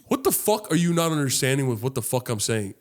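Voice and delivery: ordinary delivery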